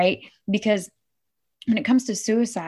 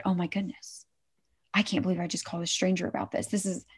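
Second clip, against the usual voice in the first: put-on embarrassed quiet kid; 7 dB quieter, faster than usual. Kid apologizes for calling stranger.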